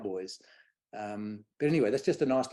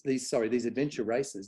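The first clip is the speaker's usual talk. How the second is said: ordinary talk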